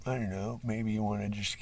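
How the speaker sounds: ordinary talk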